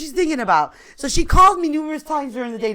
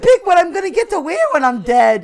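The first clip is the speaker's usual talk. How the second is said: laughing; 7 semitones higher, 5 dB louder than usual. Retold or imitated. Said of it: "I'm like".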